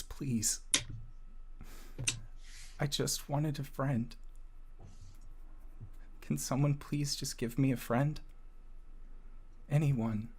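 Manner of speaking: put-on high, soft pleading child's voice; small boy choking back tears. Crying boy begging for a friend, high and small. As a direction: plead as a lonely little boy, halting between phrases. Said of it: "kind of choking back, uh, on their crying".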